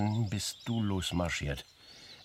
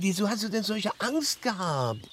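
alarmed; 13 semitones higher, 6 dB louder than usual. Acted. Anxious questioning in the scene.

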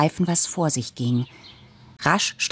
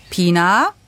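louder, higher call from upstairs; 4 semitones higher, 6 dB louder than usual. A narrator's reading. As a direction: call a name across the house. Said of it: "rief mich Papa". (lauter Ruf, höher)

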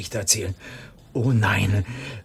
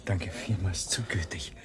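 weak, whispered thanks; barely breathing out the words; a hint of a spent, whispered thank-you; faint whisper; 4 semitones lower, 11 dB quieter than usual. Faint clerk thanks a helper.

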